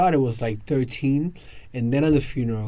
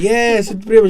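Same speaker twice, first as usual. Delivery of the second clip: higher, louder matter-of-fact; insisting relatives; 10 semitones higher, 12 dB louder than usual. Retold or imitated. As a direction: insist she is your cousin.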